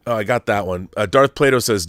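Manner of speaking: usual speaking voice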